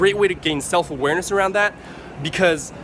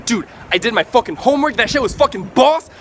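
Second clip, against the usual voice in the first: higher and louder, pumped up; excited about homework; 5 semitones higher, 4 dB louder than usual. Shown as a demonstration. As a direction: sell boring homework like a huge win. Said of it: "talk about homework in the same way".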